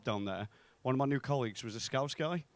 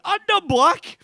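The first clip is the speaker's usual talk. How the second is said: very high, loud yell; shouted retort; 11 dB louder, slower than usual. Acted. Blind colleague retorts to the comic's joke, high shouted comeback. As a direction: yell it out at a squeaky high pitch.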